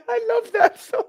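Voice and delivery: high, breathless laugh-cry; laughing gush. Real, not acted. Laughing adoration of the film.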